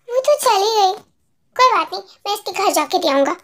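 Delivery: ordinary delivery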